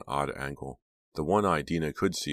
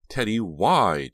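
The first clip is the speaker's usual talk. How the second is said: high, loud, slow cry; shrill cry; 6 semitones higher, 9 dB louder, slower than usual. A narrator's reading. Jade crying out at Teddy, shrill cry. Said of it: "Jade cried shrilly".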